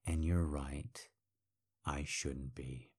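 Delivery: breathy whispered concession; a cold whisper right after the shouting. Acted. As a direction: drop suddenly to a whisper. Sudden drop after yelling.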